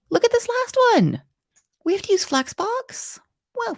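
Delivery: high, loud delighted exclaim; thrilled by what she just found; excited. Real, not acted. Teacher showing students the Flexbox task, high excited exclaim.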